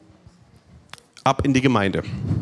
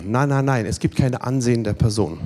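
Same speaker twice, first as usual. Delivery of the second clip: usual speaking voice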